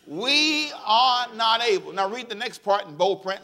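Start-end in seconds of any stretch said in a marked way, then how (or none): 0.0-1.9 slow, drawn-out, leading the crowd. Shown as a demonstration. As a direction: draw it out and wait for them. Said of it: "Say it again, come on".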